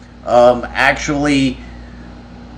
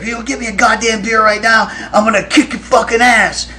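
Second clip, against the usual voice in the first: high-pitched angry threat, imitated; retold yelling; 9 semitones higher than usual. Kirk threatening Ben over a beer, imitated yelling. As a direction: yell a threat at your bandmate. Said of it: "he's yelling at Ben".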